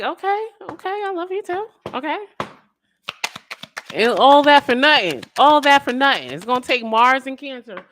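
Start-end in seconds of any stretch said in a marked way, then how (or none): 0.0-2.2 much higher, flustered mock voice; mock flustered reply. Partner answering the love confession, high flustered mock voice. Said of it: "This person's gonna be like". 3.9-6.3 louder, higher lament; emphatic outcry. Real, not acted. Reader exclaiming over wasted feelings, loud lament.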